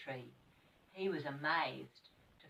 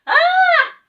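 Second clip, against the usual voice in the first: very high shrill scream; screaming when splashed; 22 dB louder than usual. Acted. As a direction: shriek as the water hits. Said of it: "She gave a scream".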